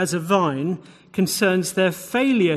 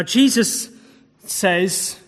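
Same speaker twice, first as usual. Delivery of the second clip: usual speaking voice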